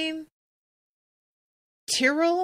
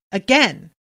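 louder one-word command with a falling tune; curt order; 3 semitones higher, 5 dB louder than usual. A narrator's reading. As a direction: snap it out to a tired pupil. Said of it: "Kelsa barked".

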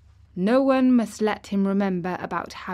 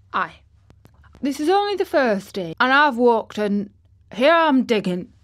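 put-on high, loud boy putting on Yorkshire; triumphant attempt at Yorkshire dialect; exultant Yorkshire; 4 semitones higher, 7 dB louder than usual. A narrator's reading. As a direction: crow it in broad Yorkshire. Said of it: "spoke exultantly to Dickon in his best Yorkshire".

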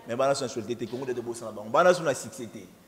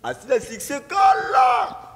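excited shout; 12 semitones higher, 8 dB louder, much slower than usual. Real, not acted. Excited cry about hit song.

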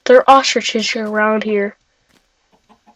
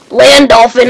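1 excited call; 7 dB louder, slower than usual. Real, not acted.

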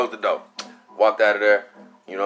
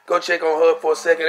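talking himself into checking on her, pitched way up; retelling his own eager thoughts, much higher; high excited self-talk; 6 semitones higher than usual. Retold or imitated. Retold excited self-talk about Callie.

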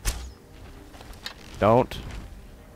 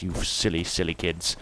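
ordinary talk

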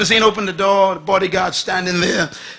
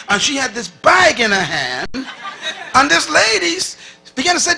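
normal talking voice

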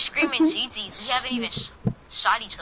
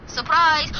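normal talking voice

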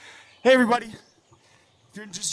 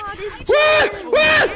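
real shock at being tagged; yelped double what; shocked disbelief. Real, not acted. Caught out in the game.

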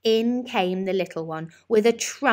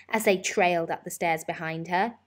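ordinary delivery, nothing marked

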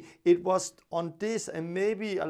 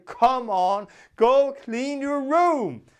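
louder, higher, slow angry command; angry dad; 6 semitones higher, 7 dB louder, slower than usual. Retold or imitated. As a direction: order them firmly, losing patience. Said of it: "I would be, like, angry".

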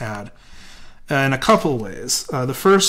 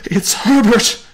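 high, loud scream of recognition; old woman screaming out her son's name; 11 semitones higher, 9 dB louder, slower than usual. A narrator's reading. Mother realising her son is at the door, screaming. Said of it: "she screamed".

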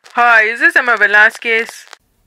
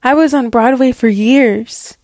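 usual speaking voice

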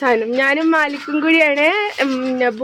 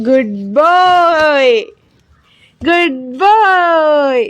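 drawn-out praise; 3 semitones higher, 5 dB louder, much slower than usual. Real, not acted. Mother praising her baby boy, slow and stretched.

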